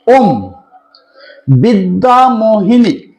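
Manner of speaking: slow, deliberate chant of the mantra; slow dictation chant. Shown as a demonstration. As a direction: drag each syllable out so it can be written down. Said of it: "আমি মন্ত্র খুব ধীরে বলছি".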